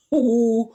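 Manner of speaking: short loud real laugh; burst of laughter. Real, not acted. Vlogger cracking up at her own remark, loud laugh. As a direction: laugh out at your own joke.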